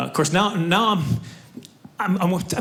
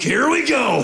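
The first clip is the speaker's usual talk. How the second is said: high, mock excited shout; hammed-up cry of gleeful anticipation; eager guard; 8 semitones higher, 3 dB louder, slower than usual. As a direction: launch into it with a raised, shouted pitch. Imagined armed guard eager to shoot.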